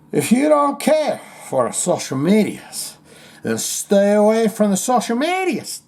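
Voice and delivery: put-on whiny Spanish-accented voice, pitched high; hammed-up, high whine with a Spanish accent. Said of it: "in a [name] kind of way".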